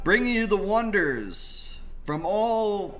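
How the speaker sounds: loud, high ringmaster call; booming announcer. Acted. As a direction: boom it out like a circus ringmaster. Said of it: "booming voice".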